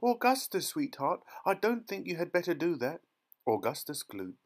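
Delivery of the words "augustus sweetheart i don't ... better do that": high-pitched fretting mother voice; high, worried maternal warning; anxious mother calling. A narrator's reading. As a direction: call out, then coax the boy. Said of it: "shouted Missus Gloop".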